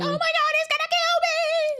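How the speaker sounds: very high-pitched imitation scream; hammed-up cry for help, squealed high; mock panicked yell. Host imitating Allie yelling to the girls, mock terrified shriek. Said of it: "kinda yelling, like".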